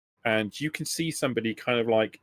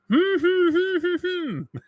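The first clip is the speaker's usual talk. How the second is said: loud, very high urgent hums; squealed string of muffled "hmm"s; muffled captive humming; 5 dB louder than usual. Acted. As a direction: protest wordlessly through a covered mouth. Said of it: "So he says".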